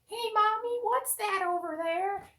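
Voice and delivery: put-on very high squeaky kid voice; little kid. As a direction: pipe up like a child asking mommy. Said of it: "kid over on a hill ... is probably like".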